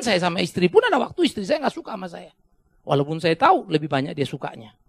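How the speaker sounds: ordinary delivery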